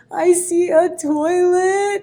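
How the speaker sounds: put-on a crying kid answering reluctantly, slowly; tearful child. Crying student naming what she sees to teacher, slow tearful child. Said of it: "and she goes".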